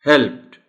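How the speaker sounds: helped, the t just barely voiced; correct pronunciation. Shown as a demonstration. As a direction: say helped ending in a light t. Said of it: "the word will be pronounced as".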